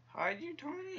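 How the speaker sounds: very high, squeaky plea; desperate urging. Real, not acted. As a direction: voice shoots way up at the end. Pleading as character won't hide.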